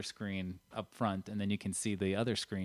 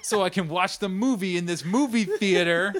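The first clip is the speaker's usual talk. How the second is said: entitled customer impression; 10 semitones higher, 9 dB louder than usual. Continues mock rude customer.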